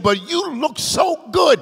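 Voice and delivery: mildly acted astonishment; amazed retold verdict.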